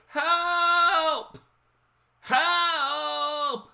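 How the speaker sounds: imitated old lady's high, loud wail; long drawn-out high cry for help; screaming for help. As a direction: wail it like a distressed old lady. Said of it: "she's screaming for help".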